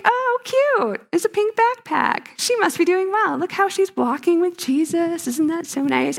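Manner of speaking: high, sing-out admiring voice, easing down by the end; cooing onlooker. Acted. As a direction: gush like a clueless, admiring bystander.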